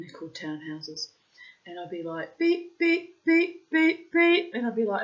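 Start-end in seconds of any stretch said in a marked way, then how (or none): 2.4-4.5 2 very high, loud mock radar beeps; 3 beep like a metal detector, pitched way up. 4 wife hunting chocolate like a radar, high loud beeps.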